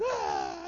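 loud mock cry of pain; imitated child crying. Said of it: "He cries".

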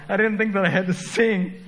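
high-pitched comic wail of retold tears; mock crying. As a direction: imitate a kid crying home in dismay. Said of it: "ran home and cried and said".

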